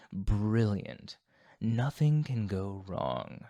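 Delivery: mock-enthusiastic, slowing on the last words; bitter sarcasm. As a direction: sarcastically praise a disastrous plan. Mocks the disastrous gathering plan.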